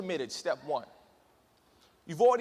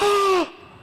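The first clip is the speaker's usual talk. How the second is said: sharp high gasp; shocked gasp; 12 semitones higher, 9 dB louder than usual. Retold or imitated. Group on bridge watching friend fall, sharp gasp. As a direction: gasp in horror. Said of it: "And we're like".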